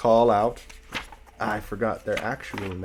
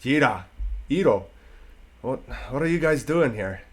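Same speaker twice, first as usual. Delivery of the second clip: gruff dwarf voice; 4 semitones higher than usual. Acted. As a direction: call out to the wolves like a rough dwarf. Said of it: "you hear a gruff male voice call out".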